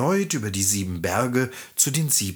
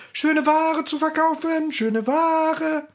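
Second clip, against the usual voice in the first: put-on high old-woman voice calling her wares; high hawker's call; old peddler woman calling. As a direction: cry out your goods at the door as a disguised hag. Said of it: "klopfte an die Tür und rief".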